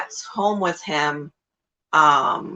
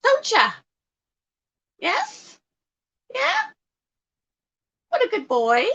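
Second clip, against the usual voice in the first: praising the bird. Real, not acted. Owner praising her bird as a good boy, very high baby talk. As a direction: gush up high to a pet.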